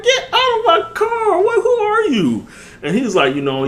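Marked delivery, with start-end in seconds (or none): 0.0-2.5 very high, slow, laughing alarmed cry; mock panic. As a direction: act out a panicked shriek at a stranger, half laughing. Stranger opens car door.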